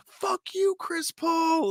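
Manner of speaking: high-pitched mock shout, held back in volume; drawn-out, high imitation of an arena heckle; imitated heckler yell. Retelling an angry fan at the game, high mock yell. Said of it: "super loud in the arena".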